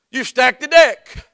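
slow, loud protest; slow preaching emphasis. Acted. Preacher mock-protesting a stacked trial, slow. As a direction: lean on each word.

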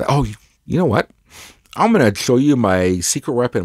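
usual speaking voice

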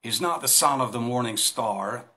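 ordinary talk

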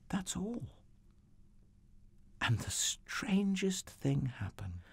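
high, soft near-whisper; hushed wonder. A narrator's reading. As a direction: tell it as a marvel, very softly. Narrator in awed hush.

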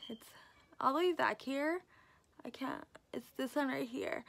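talking with cheek pulled back; finger in mouth. Real, not acted. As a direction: lip stretched by finger. Seen 1: Finger hooks mouth corner, pulling cheek back to show teeth.

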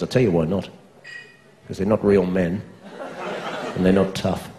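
low, confiding bloke voice; hushed, dead-serious mock explanation; homophobic mate.

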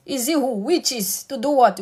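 ordinary delivery

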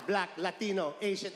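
normal talking voice

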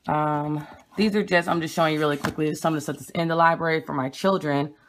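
ordinary delivery

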